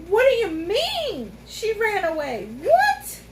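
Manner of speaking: high, loud disbelieving screech, peaking on the last word. A narrator's reading. Girl hears the unicorn ran away, high outraged screech. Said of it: "Allegra screeched".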